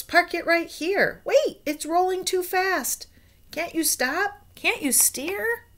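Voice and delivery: high, loud panicked shout; monkey shouting in alarm. Acted. Monkey yelling at the driver to stop, panicked shout. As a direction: yell at the runaway car to stop. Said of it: "one little monkey shouts".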